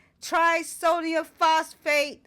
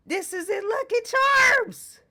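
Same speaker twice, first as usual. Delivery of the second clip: high, loud, indignant outburst; higher and louder with outrage; outraged cry; 9 semitones higher, 6 dB louder than usual. Real, not acted. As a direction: shout it in disbelief. Outraged rant about cereal ingredients.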